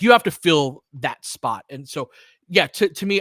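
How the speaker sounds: ordinary talk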